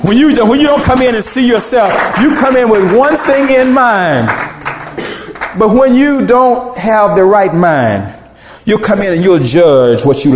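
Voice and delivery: high, insistent sermon delivery; impassioned preaching. Real, not acted. Preacher admonishes worshippers who come in seeing themselves, impassioned preaching. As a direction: lift the voice and press the point.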